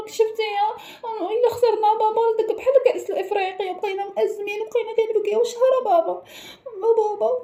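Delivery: high, teary whine; tearful child. Acted. Child tearfully telling dad he fears losing. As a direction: whimper it like a scared kid.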